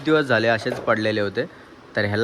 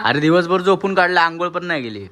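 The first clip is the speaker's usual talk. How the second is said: higher-pitched, indignant protest; indignant complaint; 6 semitones higher, 3 dB louder than usual. Real, not acted. Child telling mother papa slept all day, indignant. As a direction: argue back, voice raised in pitch.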